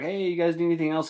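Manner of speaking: high, careful waiter sing-out; lightly voiced waiter. Waiter approaching frightened kids' table, lightly chirpy.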